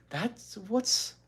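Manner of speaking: high puzzled question, not whispered; puzzled girl. Acted. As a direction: ask in bafflement. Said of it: "whispered Beezus".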